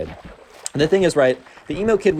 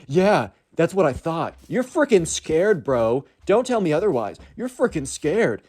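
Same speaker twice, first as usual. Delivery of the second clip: slow, higher mocking taunt; taunting emo kid; 5 semitones higher, slower than usual. Emo kid taunts Hank before his friends, slow mock sneer. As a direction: sneer it like a teen showing off.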